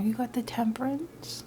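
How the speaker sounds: slow, whispered surprise; whispering as the Temperance card turns up; awed whisper. Real, not acted. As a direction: breathe it out in quiet awe. Surprised card reveal, hushed.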